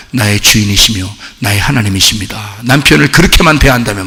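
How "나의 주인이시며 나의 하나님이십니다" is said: low, measured reverent address. Acted. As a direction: honour your husband as lord, understated. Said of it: "아브라함한테 사라가 하는 것처럼". (낮고 차분하게 공경하는 아내 말투)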